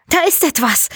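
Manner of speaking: high-pitched, startled report; alarmed radio call. A narrator's reading. Jelena radios her sighting to the men, alarmed. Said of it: "erklang Jelenas Stimme endlich wieder".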